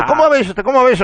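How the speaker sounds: high teasing nose-stealing game; playful granny voice. Retold or imitated. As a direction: tease like a granny with a child. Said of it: "sale con el". (voz aguda de abuela jugando)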